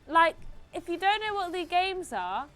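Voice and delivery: P=A; emphatic rant. Real, not acted. Ranting at commenters.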